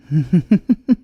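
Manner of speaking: short loud mocking laugh; loud teasing chuckle; amused laugh. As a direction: laugh at the silence. Amused at the silent inspector.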